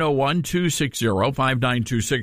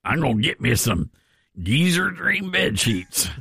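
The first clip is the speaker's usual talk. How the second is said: put-on Southern accent. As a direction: play the mock Southern country customer. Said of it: "I think it's your Southern accent".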